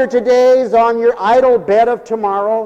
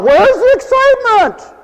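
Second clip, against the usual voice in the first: much higher, louder mock yell; 5 dB louder than usual. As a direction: call it out high and loud. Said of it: "you'll yell out".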